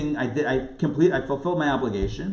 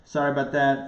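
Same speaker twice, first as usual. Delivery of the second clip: usual speaking voice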